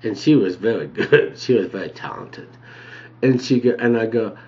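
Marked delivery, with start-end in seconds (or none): none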